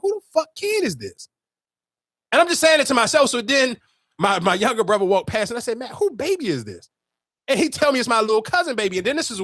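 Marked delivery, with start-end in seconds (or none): 0.0-1.3 high-pitched baffled question; retold disbelief, pitched way up; incredulous. Retelling his puzzled reaction. 5.8-6.9 baffled. Retold or imitated. Retold question to younger brother.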